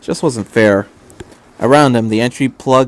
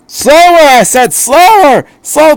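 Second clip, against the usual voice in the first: much higher, loud shouted order; panicked yell; 8 dB louder, slower than usual. Acted. Doctor shouting at Shinji as he tumbles, panicked yell. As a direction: scream at the pilot to slow down.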